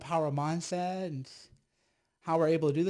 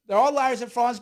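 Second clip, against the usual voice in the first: louder and higher, indignant; loud outburst; 7 semitones higher, 9 dB louder than usual. Real, not acted. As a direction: raise your voice, call them out. Rant building up.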